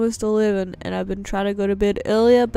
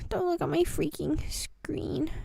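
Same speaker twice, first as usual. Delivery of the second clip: whisper; 7 semitones higher, 6 dB quieter than usual. Real, not acted. Flustered whisper.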